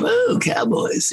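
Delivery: high breathy mock swoon; imitating English women. English lady cooing at the cowboys, mock high voice.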